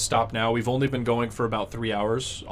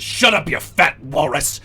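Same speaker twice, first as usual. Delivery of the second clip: giant's insult; 5 dB louder than usual. Acted. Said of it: "he says".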